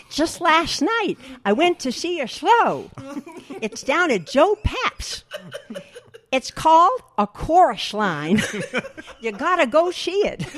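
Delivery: "just last night" and "show" with every s slushed out the sides, high, loud and slow; slushy lisp on "Chorus Line" and "see", pitched high, laughing through it; double lateral lisp. Retold or imitated. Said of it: "he had a double lateral lisp".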